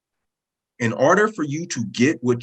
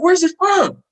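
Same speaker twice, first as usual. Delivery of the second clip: put-on loud, very high excited kid voice; high-pitched, thrilled mimicry of a kid; excited daughter; 7 dB louder, slower than usual. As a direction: imitate a child squealing while jumping around. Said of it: "started jumping around. She's like".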